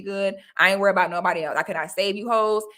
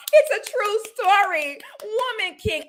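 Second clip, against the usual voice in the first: high mock-gushing fan voice; 11 semitones higher than usual. Vlogger imitating fans praising the movie, high mock gush. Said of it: "watching you hoes talk about".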